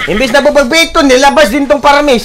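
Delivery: high, agitated protest; heated exclaiming. Real, not acted. Gamer reacting to an escape in game, agitated. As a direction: protest with the pitch pushed way up.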